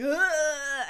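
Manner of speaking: loud, high mock cry of pain; imitated pained scream. Imitating the man as his hearing aids zap, high scream. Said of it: "He's, like, going".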